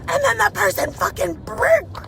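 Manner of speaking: high, loud, startled exclaiming; shocked driver spotting a crash. Real, not acted. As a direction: blurt it out in alarm. Sees a crash while driving.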